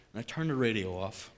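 usual speaking voice

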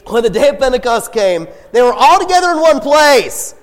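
an energized loud reread. Shown as a demonstration. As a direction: read it again with big energy, loud and high. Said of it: "I need some object lessons, some fun".

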